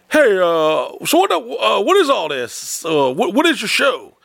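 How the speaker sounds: imitating a baffled owner, pitched high; mock-confused club boss; bewildered club owner. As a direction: ask haltingly, voice climbing high. Puzzled club owner acted.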